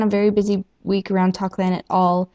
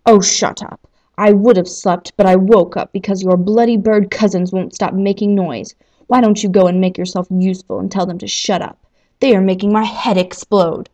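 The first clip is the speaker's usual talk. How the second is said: louder, irritable hungover grumble; grumpy hungover dwarf; 4 dB louder than usual. Acted. Hungover dwarf snapping at the feathered lady, loud and grumpy. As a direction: snap back at her, head pounding.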